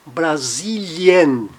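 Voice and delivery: Brasilien drawn out as "bra-ZEE-li-en"; IE as two vowels. Shown as a demonstration. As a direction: slowly sound I then E at the end of Brasilien. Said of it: "é pronunciado com I, E".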